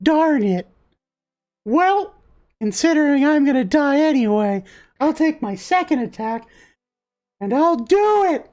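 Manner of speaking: high, plucky cookie voice; squeaky resolve of a doomed little fighter; desperate gingerbread boy. Acted. Gingerbread boy facing death, desperately determined.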